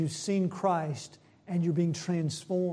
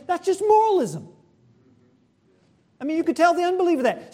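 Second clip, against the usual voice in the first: raised, much higher preaching voice; impassioned preaching; 12 semitones higher, 8 dB louder, much faster than usual. Real, not acted. Preacher dismisses moralism to the church, impassioned. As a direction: drive it home forcefully to the congregation.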